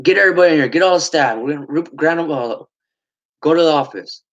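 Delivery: heated mimicked rallying orders; retold angry orders. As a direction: imitate a fired-up boss rounding up staff. Retold leader ordering staff.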